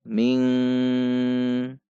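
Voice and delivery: "ming" dengan ng jelas dan panjang; dengung yang salah. Shown as a demonstration. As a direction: tahan bunyi ng pada "ming". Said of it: "juga bukan dibaca". (contoh salah: "ming" mendengung)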